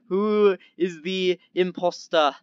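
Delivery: slow, put-on weird accent; playful odd accent, dropped R endings. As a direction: put on a silly, drawn-out accent. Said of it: "doing this weird accent".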